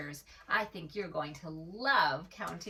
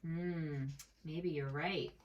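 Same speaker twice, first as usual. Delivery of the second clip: ordinary delivery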